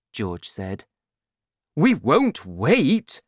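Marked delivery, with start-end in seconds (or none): 1.7-3.2 slow, loud, higher protest; excited shout. A narrator's reading. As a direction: shout down the interruption, drawing out each word. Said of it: "Mister Cranky shouted".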